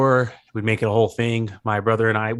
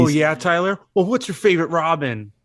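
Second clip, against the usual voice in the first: higher, smug fan voice; know-it-all gatekeeper; 6 semitones higher than usual. Acted. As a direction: quiz him like a snooty superfan. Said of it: "that's my character, the, the, um, actually gatekeeper".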